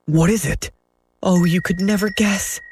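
high, eager fairy voice; bursting with news; excited cry. A narrator's reading. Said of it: "she cried".

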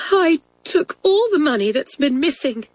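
high, tearful defiance; defiant. Acted. Girl owns up to stealing, defiant. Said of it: "Kathleen stared at her defiantly".

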